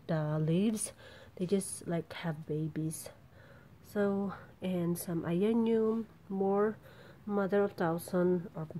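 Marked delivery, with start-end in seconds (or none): none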